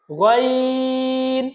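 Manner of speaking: গইন from upper throat, long level vowel; upper-throat articulation. Shown as a demonstration. Teacher models the throat letter, গইন drawn out. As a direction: chant গইন with a throaty first sound. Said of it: "কণ্ঠনালীর উপরিভাগ থেকে".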